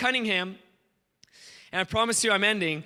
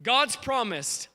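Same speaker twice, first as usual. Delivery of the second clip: ordinary talk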